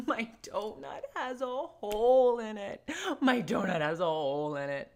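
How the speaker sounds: mock tantrum wail, laughing through it; mock wailing lament. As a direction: play the toddler's overblown lament. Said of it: "biblical level lament".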